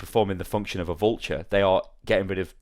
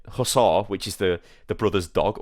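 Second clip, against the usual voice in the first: plain reading voice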